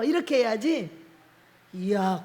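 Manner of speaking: ordinary talk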